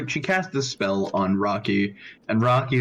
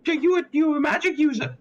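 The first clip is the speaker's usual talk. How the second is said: high-pitched curious noblewoman; high lady voice. Acted. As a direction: ask with sudden interest. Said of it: "she looks at you and she goes".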